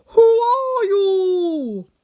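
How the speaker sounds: very long, high, loud shout; crying out. A narrator's reading. Tin Woodman rushes at the little man, crying out loudly. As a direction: holler it, stretching every word. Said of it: "and cried out".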